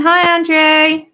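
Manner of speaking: passing on a greeting across the room; calling a greeting. Retold or imitated. Relays viewers' greeting.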